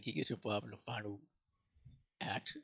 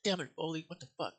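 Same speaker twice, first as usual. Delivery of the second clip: high, loud mock outrage at Foley; exasperated outburst; 9 semitones higher, 5 dB louder than usual. Reviewer reacting to a twist in the movie, high loud mock exasperation. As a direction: blurt it out like you just got caught off guard.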